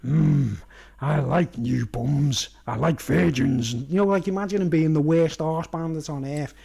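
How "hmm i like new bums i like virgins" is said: low, slow, leering voice; lecherous drawl. Retold or imitated. Mango below the bunk leering at Boss Nana, slow and low. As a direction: ooze creepy menace. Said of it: "Mango's beneath him going".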